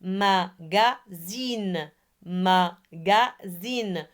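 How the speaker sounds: MAGAZINE slowly, split ma-ga-zine; three separate beats on "Magazine"; syllable by syllable. Shown as a demonstration. Teacher models Magazine for pupils, syllable by syllable. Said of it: "Ce sont les nombres de syllabes".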